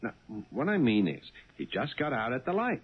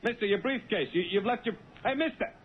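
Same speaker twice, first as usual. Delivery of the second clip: calling out after a man, pitched well higher; 7 semitones higher than usual. Acted. Cabbie calling after the departing passenger, pitched-up call. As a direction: shout after him before he's gone.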